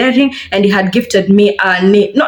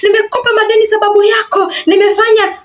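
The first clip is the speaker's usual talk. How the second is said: high, fast, hammed-up accusing boyfriend voice; mock jealous rant; 13 semitones higher, faster than usual. Jealous boyfriend accusing her of seeing other men, high hammed-up rant. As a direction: imitate him ranting, pitched way up and rushed. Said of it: "he flips and is like".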